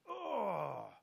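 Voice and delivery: long, falling "oh" of a sour stomach; sick groan. Acted. Preacher acting out bitter Scripture in the stomach, a pained groan. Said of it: "bitter in your stomach 'cause you go".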